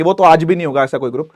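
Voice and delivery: normal talking voice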